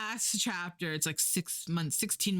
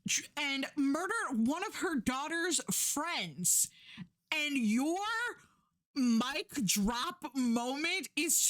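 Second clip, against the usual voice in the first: outraged rant; 7 semitones higher than usual. Real, not acted. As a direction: build the outrage line by line. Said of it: "I was so fucking mad".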